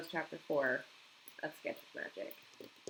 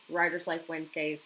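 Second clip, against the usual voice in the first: ordinary talk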